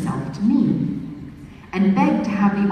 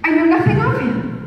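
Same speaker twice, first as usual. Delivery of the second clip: higher, a touch louder peevish retort; mildly peevish young lady brushing him off; 8 semitones higher, 4 dB louder than usual. Acted. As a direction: snap back, annoyed at the stranger. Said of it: "I said I peevishly".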